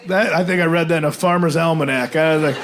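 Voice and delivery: ordinary delivery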